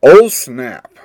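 loud "Oh, snap" with a falling "oh"; slangy exclamation. Shown as a demonstration. Podcaster demoing old slang, loud exclamation. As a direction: blurt out "Oh, snap" like a kid in the nineties.

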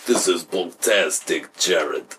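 slow, low fake Russian accent; low, deliberate Russian impersonation; mock Russian accent. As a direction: drag it out like a cartoon Russian. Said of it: "They talk like that".

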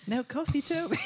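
high, mocking English host; [name] denies Toby coffee in a high English accent. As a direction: tease him in a high English voice. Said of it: "that English one".

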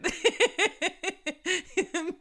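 long high giggle; amused high laughter. Real, not acted. Laughs at own eating.

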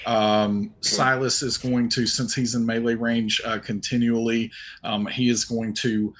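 normal talking voice

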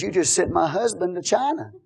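usual speaking voice

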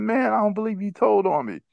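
hurt and betrayed by a friend; indignant complaint to the snitch; mad at friend. Retold or imitated. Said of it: "I was so mad at him".

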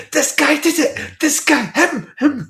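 high excited pointing yells; mock accusing. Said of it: "doing fingers above his head going".

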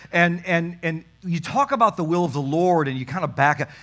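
normal talking voice

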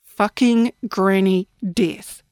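ordinary delivery, nothing marked

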